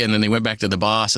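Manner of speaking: normal talking voice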